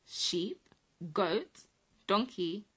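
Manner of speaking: plain reading voice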